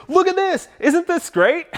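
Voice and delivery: high-pitched, eager show-off imitation; gushing salesman. Salesman showing off the digital dashboard, gushing.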